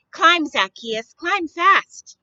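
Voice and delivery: storyteller cheering Zacchaeus up the tree. A narrator's reading. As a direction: shout encouragement up the tree. Narrator cheering him on.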